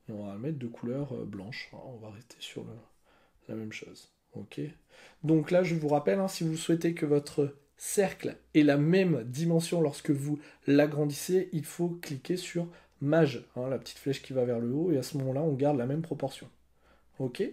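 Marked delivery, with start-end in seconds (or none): none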